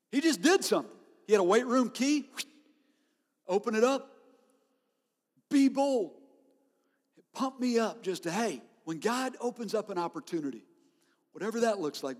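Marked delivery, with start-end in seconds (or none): 0.1-6.1 higher and louder, pumping the room up; fired-up preaching. Acted. Pastor rallying his church, fired-up preaching.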